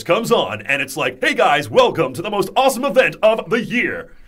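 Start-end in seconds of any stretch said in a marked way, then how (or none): 1.2-4.1 high, loud hype host voice; presenter voice. Shown as a demonstration. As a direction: welcome the crowd like an event host. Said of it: "my presenter voice".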